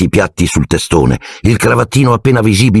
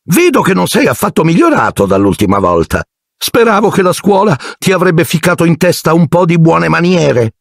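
high, disapproving aunt voice; high sneering aunt; Aunt Marge; 11 semitones higher than usual. A narrator's reading. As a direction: belittle the boy, pitched high. Marge criticizes Harry.

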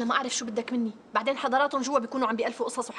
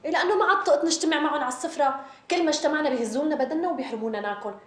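exasperated complaint; 6 semitones higher, 4 dB louder than usual. Acted. Complaining about relatives at meals.

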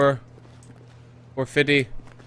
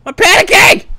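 high, rattled gamer's cry; excited, high-pitched panic; panicking; 10 semitones higher, 4 dB louder than usual. Real, not acted. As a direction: blurt it out in rising panic.

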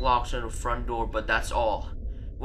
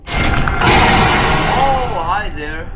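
high, loud nervous hello; nervous loud greeting; 6 semitones higher, 13 dB louder, much slower than usual. Real, not acted. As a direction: greet the monster in panic. Greets the monster loudly.

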